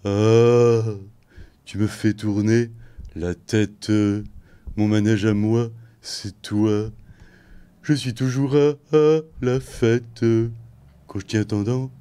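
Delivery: [name] impression. Shown as a demonstration. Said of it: "il faut faire du monocorde".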